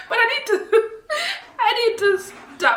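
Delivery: laughing. Real, not acted. Admitting she needs a break from the show, laughing helplessly. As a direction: giggle helplessly while admitting she must stop. Laughing at her own binge-watching.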